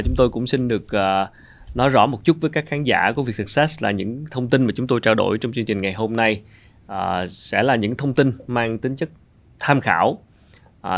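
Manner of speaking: normal talking voice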